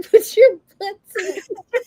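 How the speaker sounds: laughing through it. Retold or imitated. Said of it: "Instead of, like".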